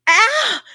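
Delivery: high, loud wounded yelp; mock shocked cry. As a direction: yelp as if stung by an insult. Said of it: "And I was like".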